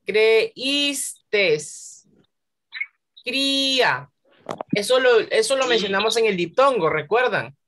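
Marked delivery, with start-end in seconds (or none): 0.1-1.6 "Creíste" broken up as cre-ÍS-te, high on the Í; slow syllable-by-syllable "Creíste"; stressed, separated I. Shown as a demonstration. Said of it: "la mayor fuerza de voz recae". 3.2-4.1 "Cría" with a long high Í, then a falling A; stressed I. Shown as a demonstration. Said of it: "la mayor fuerza de voz recae".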